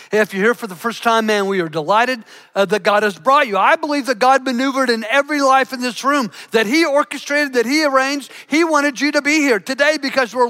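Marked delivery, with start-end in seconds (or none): none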